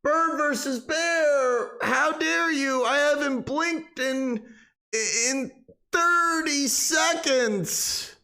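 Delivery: high, whiny mock-terrified wailing; mock panic. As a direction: wail as if the phone is eating your fingers. Phone jump-scare panic.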